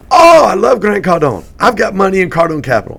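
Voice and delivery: put-on very loud, high, delighted African accent; terrible African accent. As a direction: burst out in a badly faked African accent. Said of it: "That's a terrible African accent".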